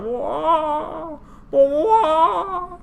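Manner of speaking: high, drawn-out cartoon whoa; long high mock howl; imitated falling wail. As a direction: wail it like tumbling through the air. Imitates tangled dragons falling.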